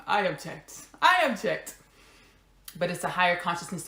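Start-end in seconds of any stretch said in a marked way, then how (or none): 1.0-1.8 high, put-on little protesting voice; objecting part of the soul. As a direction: pipe up in protest as a stubborn inner voice. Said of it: "parts of my soul that are, like, objecting".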